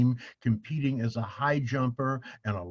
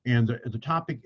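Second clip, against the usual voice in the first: usual speaking voice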